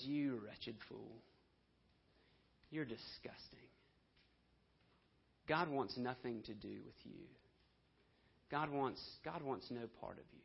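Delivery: a soft, sneering voice of shame; accusing voice. Acted. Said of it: "that accusing voice that says".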